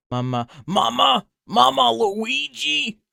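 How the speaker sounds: lightly done high cartoon plumber voice; a half-hearted stab at the Luigi voice, slow; Mama Luigi. Streamer attempting a cartoon voice for chat, high and slow. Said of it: "I can't do the voice".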